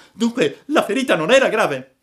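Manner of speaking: high, loud, eager puppet voice; overjoyed. A narrator's reading. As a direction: ask with excited relief. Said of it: "gridò il burattino saltando dell'allegrezza". (allegro, voce acuta da burattino)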